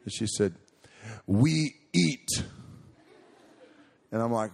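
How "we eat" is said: put-on high, loud small-girl voice with a Ugandan accent; little Ugandan accent. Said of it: "She had this really strong accent".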